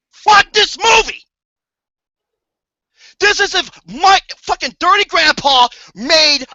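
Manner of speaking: angry shout. Real, not acted. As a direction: spit it out in disgust. Ranting reviewer loses patience.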